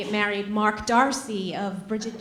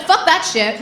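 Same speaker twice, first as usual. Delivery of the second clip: louder, higher defiant blurt; mock defiant swear; 5 semitones higher, 7 dB louder than usual. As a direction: toss it off with attitude. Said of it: "So I said".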